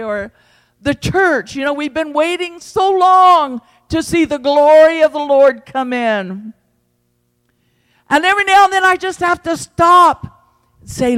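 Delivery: ordinary talk, nothing marked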